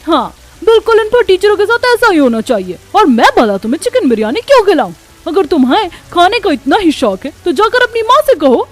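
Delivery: loud, high-pitched angry scolding. Acted. Neighbour scolding two hungry children, angry and scornful. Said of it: "पायल गुस्से में उन्हें कहती है".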